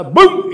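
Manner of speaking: high, loud mock thud; comic boom of the fall; loud crash sound. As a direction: bang out the crash.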